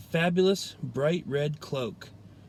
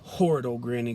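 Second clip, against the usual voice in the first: wolf growling; 3 semitones lower, faster than usual. A narrator's reading. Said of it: "the wolf growled".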